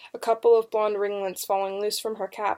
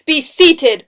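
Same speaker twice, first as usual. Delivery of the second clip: loud, raised judge's command; booming command; 7 semitones higher, 13 dB louder than usual. A narrator's reading. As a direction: boom it across the courtroom. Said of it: "commanded in his booming voice".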